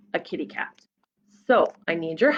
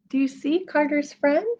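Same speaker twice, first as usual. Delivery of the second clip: ordinary delivery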